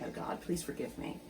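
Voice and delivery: hushed, breathless whisper; whispered prayer. Retold or imitated. Cook retelling her Sunday slip, whispering a prayer. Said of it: "it really was a prayer".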